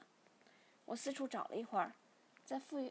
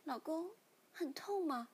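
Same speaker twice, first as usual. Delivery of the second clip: tender wife; 6 semitones higher than usual. A narrator's reading. Wife asks husband if it hurts, tender and slow. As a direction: ask tenderly, drawing the words out. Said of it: "子琪摸着我的脸说".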